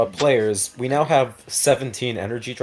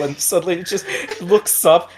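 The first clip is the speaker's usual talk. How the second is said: normal talking voice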